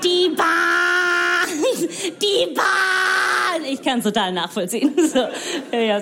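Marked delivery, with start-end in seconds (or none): 0.0-1.9 higher, rising mock lament; growing wail. Shown as a demonstration. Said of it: "repeated complaint". 2.2-3.7 much higher shouted lament; mock anguished shout. Shown as a demonstration. Comic mocking Germans griping about the Bahn, hammed-up shout. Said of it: "repeated complaint".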